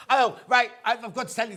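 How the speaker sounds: bursting into a laugh as she starts. Real, not acted. Presenter laughing to her guest, laughing.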